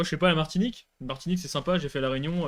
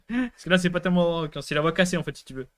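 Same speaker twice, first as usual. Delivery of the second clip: ordinary talk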